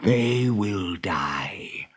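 very slow, whispery threat; menacing villain. Acted. Hook dooms the boys with his plan, slow menace. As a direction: drop low and draw out each word. Villain pronounces the boys' doom.

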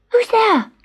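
nervous kitten. A narrator's reading. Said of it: "Gobolino sat up and whispered".

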